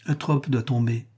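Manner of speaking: ordinary delivery, nothing marked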